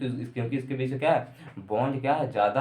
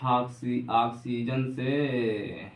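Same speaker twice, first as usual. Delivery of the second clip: very slow, words drawn out; slow writing-along pace; much slower than usual. Real, not acted. Teacher writing a heading for students, slow and drawn out. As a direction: stretch each word out as you write it.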